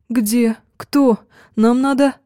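high, rushed panicked fragments; anxious babbling. Acted. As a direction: tumble out the words in panic. Friend starts babbling anxiously. (взволнованно)

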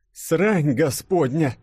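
drawn-out, high exclamation of disgust; grumbled curse. A narrator's reading. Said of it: "пробурчал он". (протяжное ворчливое ругательство)

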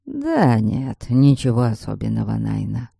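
low, muttered coachman's voice; grumbling coachman. A narrator's reading. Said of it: "пробурчал мужчина".

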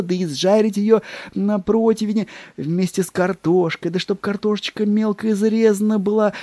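high, eager, relishing clerk; fawning secretary voice. Acted. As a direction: describe the duck like a tempting treat. Secretary raptures over duck.

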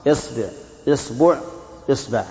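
إصبع said isbu, isba, isbi; إصبع with initial i, three variants; kasra on hamza. Shown as a demonstration. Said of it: "كسرها".

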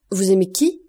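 astonished loud question; startled cry. Acted. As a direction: call it out in shock, leaping up. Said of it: "s'écria Monte Cristo".

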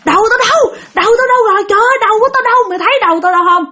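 high-pitched acted wail; frantic wailing. Possessed friend asking for his head, high frantic wail. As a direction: cry out in mock panic, pitch way up. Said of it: "cái anh Nhân này nè cứ gào".

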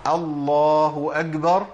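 slow chanted call to prayer "الله أكبر" with k turned to g; muezzin-like "الله أكبر" sounded as "agbar"; kaf without breath. Retold or imitated. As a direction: intone "أكبر" with a hard voiced g for the kaf. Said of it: "منع إيه؟ عن الكاف النفس".

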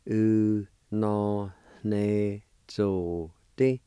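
U no ne zo te drawn out slowly. Shown as a demonstration. Teacher slowly saying the whole word. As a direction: stretch U no ne zo te out. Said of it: "Sounds like this".

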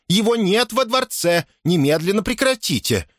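high, sustained outraged protest; indignant. A narrator's reading. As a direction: order her to stop as a scandalised housekeeper. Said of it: "возмутилась домоправительница".

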